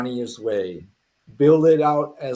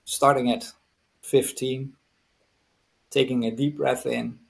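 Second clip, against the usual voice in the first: soothing induction, sinking gradually; guided hypnosis; 4 semitones lower than usual. Real, not acted. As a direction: ease them into relaxation with measured pauses. Starts leading a hypnosis relaxation.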